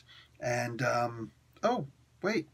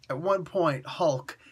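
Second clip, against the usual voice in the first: usual speaking voice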